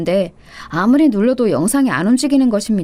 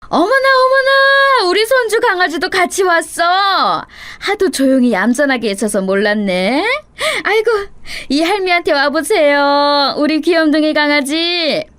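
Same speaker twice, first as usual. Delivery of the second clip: beaming greeting; 6 semitones higher than usual. A narrator's reading. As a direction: gush over the little grandchild in a sing-song. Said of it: "돌연 환하게 웃으면서 인사를 했죠".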